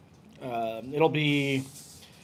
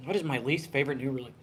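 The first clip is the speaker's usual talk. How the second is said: ordinary delivery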